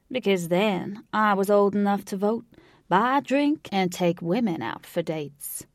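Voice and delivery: put-on easygoing elderly Southerner, stretching the words; old Southern cowboy. A narrator's reading.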